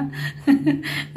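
short unvoiced giggle; breathy giggle. Real, not acted. Laughs after teasing viewers.

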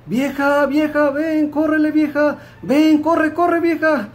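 high-pitched urgent holler for the wife; calling out to wife. Acted. Man yells for his wife to run over, high urgent holler. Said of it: "gritándole a su mujer".

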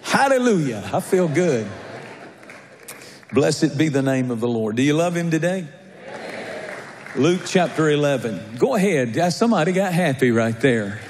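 fervent pulpit preaching. Acted. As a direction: preach it out to the congregation. Preaching to congregation.

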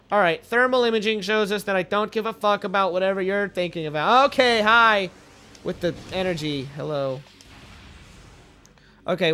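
ordinary talk

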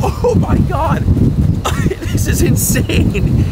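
slow words through laughter; laughing in disbelief. Real, not acted. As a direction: laugh it out, overwhelmed. Soaked and laughing on the boat.

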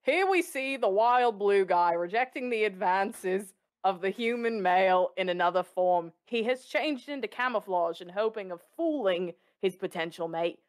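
put-on broad Australian wildlife documentary accent; mock nature documentary in a wide Australian accent; Nat Geo narrator. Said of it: "my Nat Geo accent goes very much Australian".

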